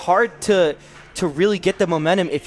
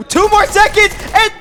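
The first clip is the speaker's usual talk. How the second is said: high excited yell, fast; 6 dB louder than usual. Real, not acted. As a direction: scream the final seconds in hype. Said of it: "Sorry for yelling".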